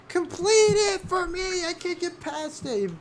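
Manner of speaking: high, slow, crying plea; whiny crying child. Retold or imitated. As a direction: beg like a sobbing little kid. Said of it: "I used to cry like a baby".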